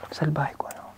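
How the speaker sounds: playful whisper to the camera. Real, not acted. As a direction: whisper it like a secret aside. Teacher jokes about being naughty.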